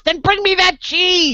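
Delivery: very high, drawn-out duck demand; triumphant little duck. Acted. Duck springing the punchline on the bartender, high demanding cry. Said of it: "And he goes".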